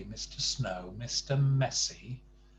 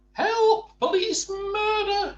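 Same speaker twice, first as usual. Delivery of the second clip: very high, loud, panicked scream for help; terrified shout; 10 dB louder, slower than usual. Acted. Mister Jelly screams for help as a leaf lands on him, very high and loud. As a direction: scream for help as if being attacked. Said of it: "he shouted".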